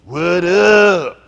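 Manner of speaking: loud, high, hollered greeting; slang call-out. Shown as a demonstration. Comic shows the gang how to get slang in their voice, hollered slang. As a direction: belt it out as a street greeting. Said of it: "get some slang in their voice".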